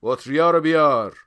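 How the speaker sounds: loud, high command; called-out order. A narrator's reading. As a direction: order someone across the room. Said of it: "رو کرد به یکی از اونا".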